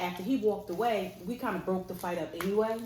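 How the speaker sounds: plain reading voice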